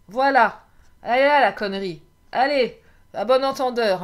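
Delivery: suddenly loud, half laughing at her own joke; playful loud self-mockery; loud cheeky outburst. Real, not acted. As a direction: blurt it out, amused and naughty.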